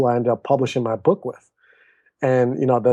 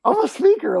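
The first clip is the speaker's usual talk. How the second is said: higher, thrilled self-announcement; excited realization; 14 semitones higher than usual. Retold or imitated. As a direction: say it like you just discovered who you are.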